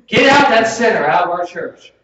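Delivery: loud, high-pitched shout to throw someone out; mock angry shout. Preacher acting out a congregation expelling a sinner, mock angry shout. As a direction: bellow it like an outraged churchgoer.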